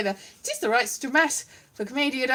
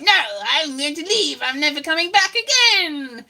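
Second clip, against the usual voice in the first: indignant puppet audience; 3 semitones higher, 4 dB louder than usual. Retold or imitated. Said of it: "It was like".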